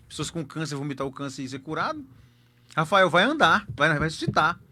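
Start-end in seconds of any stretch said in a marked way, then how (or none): 2.8-4.6 forceful loud declaration over the coffin; retold loud faith command. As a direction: call him back to life. Commanding the dead son to rise.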